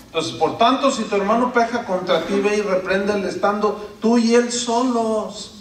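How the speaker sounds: ordinary talk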